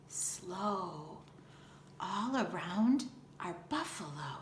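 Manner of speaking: hushed whisper while sneaking. Acted. Girls creeping through tall grass, whispering. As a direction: whisper so the buffalo don't hear.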